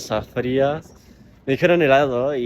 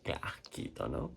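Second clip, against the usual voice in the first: disgusted whisper; 8 semitones lower, 14 dB quieter than usual. Real, not acted. Disgusted by gauze.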